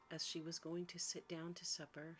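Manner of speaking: plain reading voice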